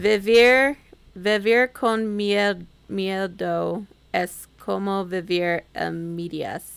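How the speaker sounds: slow, halting Spanish reading of vivir con miedo; clumsy Spanish. Shown as a demonstration. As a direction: stumble slowly through vivir con miedo es como vivir a medias. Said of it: "I really butchered that".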